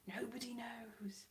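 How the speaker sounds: slow, hushed echo; mysterious whisper. Pretend. Vlogger on an accent from nowhere, mysterious whisper. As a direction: let it trail into a whisper.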